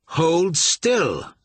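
loud, high, forceful order; furious command. Acted. York commanding Marsh in the dark, furious order. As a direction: stop him dead with fury. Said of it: "York commanded, with such force and fury".

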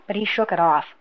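ordinary delivery, nothing marked

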